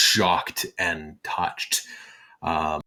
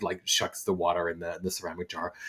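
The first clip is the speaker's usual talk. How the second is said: ordinary delivery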